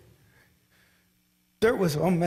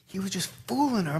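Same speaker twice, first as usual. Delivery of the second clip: normal talking voice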